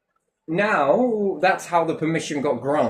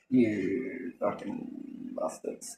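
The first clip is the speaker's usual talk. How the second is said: muttered curse; 6 semitones lower, 10 dB quieter, much slower than usual. Real, not acted. Muttered curse after mimicking them.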